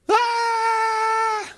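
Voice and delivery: put-on high wailing child's scream; tantrum scream. Kid denied a toy in the store, screaming a tantrum. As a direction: let out a toddler's tantrum shriek. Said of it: "A fit".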